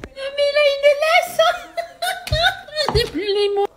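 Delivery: high voice breaking into laughter; can barely speak for laughing; squeaky giggling disbelief; laughing helplessly. Real, not acted. Finds the dog has a leash.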